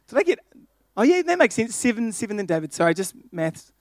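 ordinary delivery

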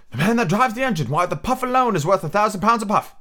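high, chanted chorus of voices; sing-song crowd chanting together; high chorus of passengers chanting in unison. A narrator's reading.

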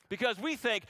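usual speaking voice

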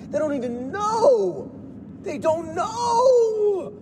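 high, drawn-out wail; wailing outburst. Acted. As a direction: cry it out, stretching KNOW. Outburst, pitch leaps, words stretched.

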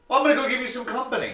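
high, fast, chirpy imitation of the customer; eager customer voice. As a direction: mimic her pushy cheerfulness, high and quick. Customer's eager quote, retold.

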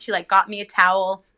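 ordinary talk, nothing marked

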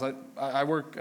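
ordinary talk, nothing marked

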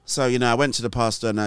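usual speaking voice

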